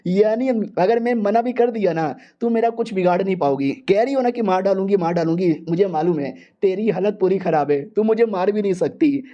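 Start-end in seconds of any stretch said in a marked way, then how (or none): none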